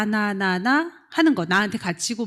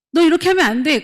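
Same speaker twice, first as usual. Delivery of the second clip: scolding; 6 semitones higher, 7 dB louder than usual. Retold or imitated. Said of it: "가르치고 싶어서 환장한 사람들은요".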